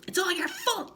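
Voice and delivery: high angry yell. Acted. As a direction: blame her with a yell. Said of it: "yelled Mister Twit".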